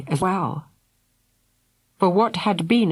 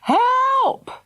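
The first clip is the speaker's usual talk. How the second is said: scream. A narrator's reading. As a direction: shout for rescue at full pitch. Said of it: "screamed Fergal".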